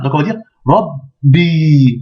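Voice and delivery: Rabbi with the b held before the i; doubled b. Shown as a demonstration. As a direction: block the b in Rabbi then release. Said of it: "Mais il y a une shedda".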